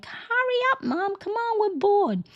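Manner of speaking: put-on high-pitched whining kids; whiny kids. Kids nagging mom to hurry, high whine. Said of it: "not be bothered by your kids going".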